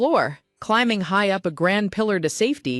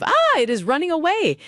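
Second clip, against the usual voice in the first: shouting; 9 semitones higher than usual. A narrator's reading. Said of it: "shouts of".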